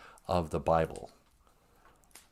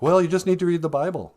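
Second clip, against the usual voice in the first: lightly voiced preachy believer, higher and quicker; mock Bible-thumping believer; 7 semitones higher, faster than usual. Believer advising a doubter, lightly mock-preachy. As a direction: mimic a believer's pat advice, pitched up. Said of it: "real Bible thumping believers will be like".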